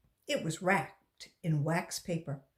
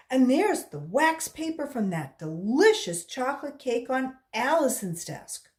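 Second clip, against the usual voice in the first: announcing; 6 semitones higher, 6 dB louder than usual. Acted. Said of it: "Joy announced".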